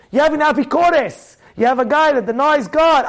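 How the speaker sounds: ordinary talk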